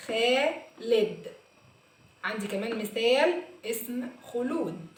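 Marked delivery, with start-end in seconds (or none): 0.1-1.3 خالد again, slowly and falling; slow repeat. Shown as a demonstration. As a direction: say خالد slowly once more.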